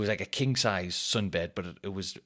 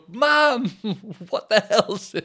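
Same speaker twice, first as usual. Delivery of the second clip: laughing; 13 semitones higher, 6 dB louder, much slower than usual. Real, not acted. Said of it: "laughing self at own performance".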